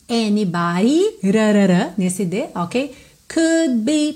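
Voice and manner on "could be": "could" with the final D left unreleased before "be"; silent D in could. Shown as a demonstration. Teacher modelling "could be" with no D. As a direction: swallow the D of "could". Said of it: "você não ouve o D do could".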